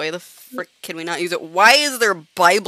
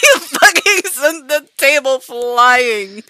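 high, loud, drawn-out exclamation; amused disbelief; 5 semitones higher, 8 dB louder, slower than usual. Real, not acted. Gamer to friend who flung the table, loud amused disbelief. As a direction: holler it slowly, stretching the words.

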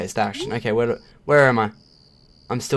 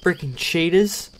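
loud stretched outraged cry; outraged shout; 6 semitones higher, 8 dB louder, slower than usual. Real, not acted. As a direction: drag it out, loud and high. Overwhelmed in fight.

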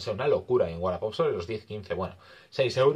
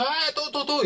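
much higher mock Maghrebi-accented seller; imitating Maghrebi accent; 4 dB louder than usual. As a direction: play the street seller with a put-on Maghrebi accent, high-pitched. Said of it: "me ha dicho".

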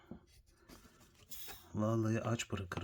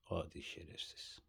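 softer, lower grumble; low mutter; 6 semitones lower, 14 dB quieter than usual. Real, not acted. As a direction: grumble it under your breath. Muttered insult at pet.

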